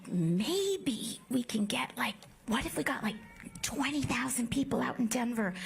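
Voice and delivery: whispering a daring hope; breathy hopeful whisper, slowed then quick; hushed whisper. Retold or imitated. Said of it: "we were like".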